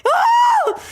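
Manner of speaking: high, loud "oh"; outraged shriek. Acted. Reacting to being asked that, outraged shriek. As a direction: squeal in mock disbelief.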